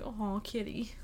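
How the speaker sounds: soft pitying whisper to a kitty; tender whisper. Retold or imitated. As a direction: whisper it with sympathy. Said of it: "I was like".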